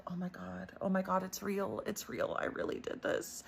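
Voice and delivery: soft, breath-held dread fading to a whisper; hushed panic. Retold or imitated. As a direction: mutter it to yourself as the fear sinks in. Realizing the haunting is real.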